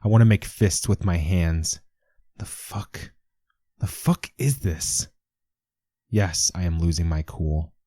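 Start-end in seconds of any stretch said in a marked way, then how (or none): 2.4-5.1 shaken disbelief. Acted. Horror at moving fronds.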